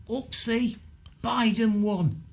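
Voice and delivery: high, slow mock-innocent voice; teasing, high-pitched mock dismay; mock oops. Mocking Boris's failed plan.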